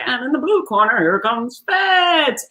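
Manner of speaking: high, drawn-out announcer call; ring announcer. Retold or imitated. Ring announcer introducing Fitz, high and drawn out. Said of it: "the announcer would be like".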